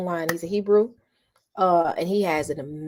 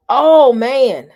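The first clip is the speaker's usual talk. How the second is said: loud, higher, delighted oh man; excited exclamation; 6 semitones higher, 13 dB louder than usual. Real, not acted. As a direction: burst out with joy at the taste. Savoring remembered food.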